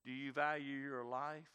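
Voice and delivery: ordinary delivery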